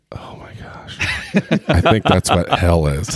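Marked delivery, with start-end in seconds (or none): 0.1-1.0 breathless whispered gasp of dread; whispered dismay. Retold or imitated. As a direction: whisper it, overwhelmed at the thought. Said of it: "I'm just sitting here going".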